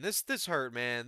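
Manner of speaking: normal talking voice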